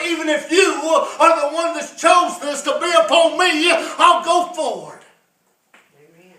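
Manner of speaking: loud, high, shouted sermon; preaching at full force. Acted. Preacher exhorting the congregation to step out in faith, shouting. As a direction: drive it home like a revival preacher.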